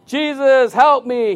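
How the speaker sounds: crying for help. Retold or imitated. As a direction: call out like you're sinking.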